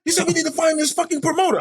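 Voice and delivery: very high, frantic impression of an associate; Dean. Associate demanding they find the promoter, frantic. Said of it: "That's Dean right there".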